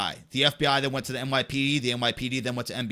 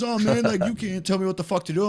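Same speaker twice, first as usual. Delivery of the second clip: raised-pitch mock protest; defiant comeback; 6 semitones higher than usual. [name] arguing back at the banging neighbor, mildly defiant. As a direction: answer back, put out.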